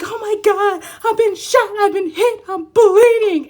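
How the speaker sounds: high, loud, laughing overacted panic; mock dramatic victim. Mimicking the client, she hams up a high, loud wounded cry. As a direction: wail like a wounded hero in a melodrama. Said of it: "that's how you were interacting".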